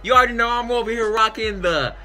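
usual speaking voice

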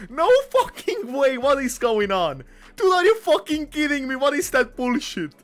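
high, loud, outraged yelling; furious disbelief. Real, not acted. Streamer yelling at his bad luck after losing, furious shout. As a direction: shout it like you just got robbed.

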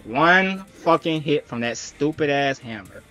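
ordinary delivery

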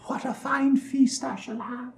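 high, whispered witch gloat; whispering to herself. A narrator's reading. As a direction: whisper it secretly, savouring it. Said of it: "she said to herself".

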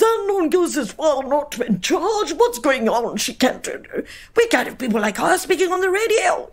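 high-pitched, drawn-out indignant caller voice; mock a scandalised caller, high and stretched out; outraged radio caller. Outraged caller complaining on air about her accent, high mock indignation. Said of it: "someone comes on, and he literally said".

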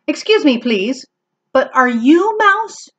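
high, polite kitten voice; polite kitten Max. Acted. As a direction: ask very politely, rising on Mouse. Max asks the fish.

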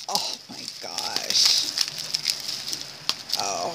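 hushed, stunned whisper; awed whisper. Real, not acted. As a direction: breathe it out in awe. Awed at the gift.

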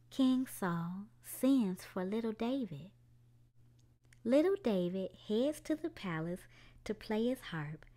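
gentle storybook narration for little ones; children's story time read-aloud. A narrator's reading. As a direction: tell it like a bedtime story. Reads a picture book aloud.

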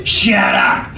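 harsh, rasping snap; rough, abrasive command; saw blade on rusted iron. A narrator's reading. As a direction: cut them off with a grating shout. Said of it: "like a saw blade on rusted iron".

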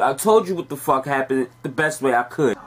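ordinary delivery

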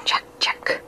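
whispered, hissing name; ghostly hiss. Acted. Ghost hisses at the hunter from the swamp, whispering. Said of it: "the voice hissed".

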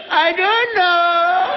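put-on drawn-out clueless child; dopey child drawl. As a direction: stretch it out like a clueless kid. Child shrugging off question.

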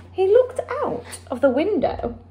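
ordinary delivery

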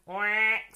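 one loud quack; duck quack. A narrator's reading. Duck joining the farmyard chorus, loud quack. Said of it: "said the duck".

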